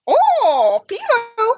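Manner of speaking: loud, very high parrot call; whoop, then squeal it like a parrot; high-pitched bird mimicry; parrot impression. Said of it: "he'll walk back and forth and he'll go".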